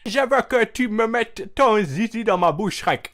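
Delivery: loud high donkey voice. Acted. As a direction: squeal it like the donkey. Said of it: "Et moi, je suis l'âne".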